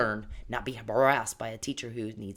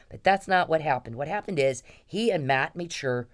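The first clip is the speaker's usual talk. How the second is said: ordinary delivery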